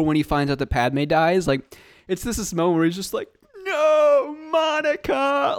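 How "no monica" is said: high, slow hammed-up wail; melodramatic acting. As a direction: wail it like terrible movie acting. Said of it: "the most dramatic, horrible acting".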